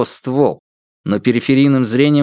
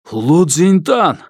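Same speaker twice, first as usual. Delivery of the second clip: furious shout; 6 semitones higher, 4 dB louder, slower than usual. Acted. Father confronts daughter's captor.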